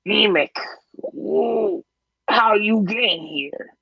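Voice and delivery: high, slow squeaky goblin; goblin guard voice. Acted. Goblin guard greeting Yemek, high squeaky surprise. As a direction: pitch it up like a startled goblin. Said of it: "he says".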